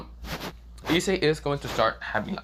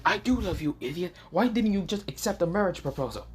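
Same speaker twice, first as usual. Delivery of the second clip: higher put-on girl's voice, exasperated; scolding a boyfriend she loves, in a higher put-on voice; girlfriend voice; 8 semitones higher than usual. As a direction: voice Ryo, fondly annoyed. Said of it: "Ryo says".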